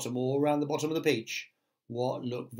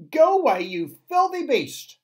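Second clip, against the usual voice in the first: high, loud, indignant shout; frightened crowd yelling; 14 semitones higher, 10 dB louder than usual. A narrator's reading. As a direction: yell it at the beast, drawing out the end. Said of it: "they shouted".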